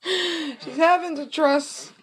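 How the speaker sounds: high, slow, laughing drawn-out line; laughing stretch. Shown as a demonstration.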